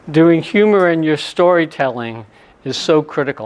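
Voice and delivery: ordinary talk, nothing marked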